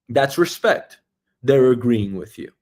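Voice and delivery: ordinary talk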